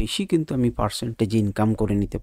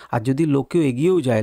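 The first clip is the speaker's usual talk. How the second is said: usual speaking voice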